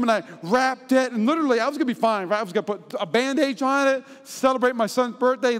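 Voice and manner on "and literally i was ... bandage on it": ordinary talk, nothing marked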